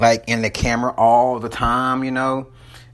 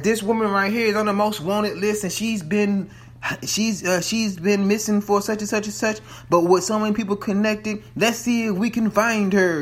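higher-pitched imitated demo host; lightly put-on presenter voice; imitating company presenters; 7 semitones higher than usual. Voices presenters demonstrating the search.

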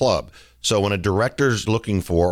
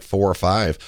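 ordinary talk

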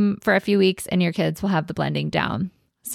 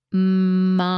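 long drawn-out word; slow blending demo; said slowly. Shown as a demonstration. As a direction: stretch each sound of the word. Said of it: "really slowly".

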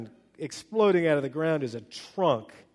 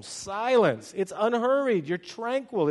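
ordinary talk, nothing marked

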